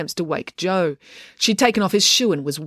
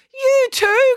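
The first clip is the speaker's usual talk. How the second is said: much higher, louder shriek; girl turning on a second boy, shrieking higher and louder; crying out; 6 dB louder than usual. Acted. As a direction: shriek the accusation. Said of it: "cried April".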